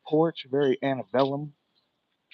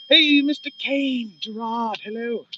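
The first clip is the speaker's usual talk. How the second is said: much higher, slow, put-on voice; silly greeting voice; 10 semitones higher, 6 dB louder, slower than usual. Streamer greeting a chat viewer in a high silly voice.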